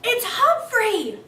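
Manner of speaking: put-on high, loud and slow child's yell; a kid yelling at the ghost. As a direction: point and shout in delight. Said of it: "she yelled".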